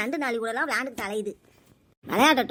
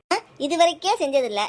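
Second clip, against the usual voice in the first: ordinary talk, nothing marked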